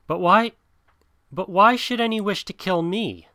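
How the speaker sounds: put-on high-pitched, bewildered young protest; startled question. A narrator's reading. Mowgli asks the panther why any would kill him, startled. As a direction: ask it high, in disbelief.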